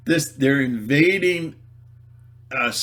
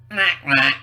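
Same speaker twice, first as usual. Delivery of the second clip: loud, higher duck impression; two punchy duck calls; duck quack; 3 semitones higher, 7 dB louder than usual. Imitates duck as riddle clue.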